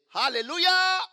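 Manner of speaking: loud, high hallelujah; preacher shouting to wake sleepers; shouted hallelujah. Shown as a demonstration. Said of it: "When you see me say".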